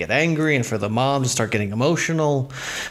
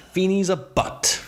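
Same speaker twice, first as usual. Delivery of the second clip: emphatic quote, higher and drawn out; slow it down and pitch it up for emphasis; 8 semitones higher than usual. Retold or imitated. Host quoting Corey's outburst about his teacher, emphatically. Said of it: "Corey says emphatically".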